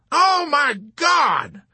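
loud, high, drawn-out wail; wailing in dismay, high and loud. A narrator's reading. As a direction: cry it out slowly in horror. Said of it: "he wailed".

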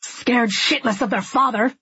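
heated comeback; snapping back. A narrator's reading. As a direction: fire back bitterly in a quarrel. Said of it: "he snaps back".

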